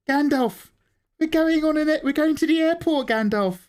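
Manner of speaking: high, bright excited voice. Pretend. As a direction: tell Gandalf the news, way up high. Talking to character, high voice.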